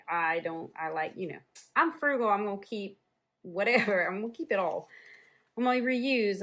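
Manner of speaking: ordinary talk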